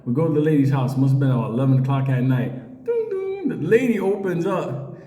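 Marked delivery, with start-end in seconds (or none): 2.9-3.5 high, sung ding ding; doorbell imitation. At the old lady's door: a high, sung doorbell chime.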